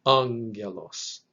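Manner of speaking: angelos slowly, stressed on ANG: ANG-gel-os; slow ANG-gel-os, emphasis up front; first-syllable stress. Shown as a demonstration. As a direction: lean on the first syllable of angelos, then fall. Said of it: "emphasis should be on that first".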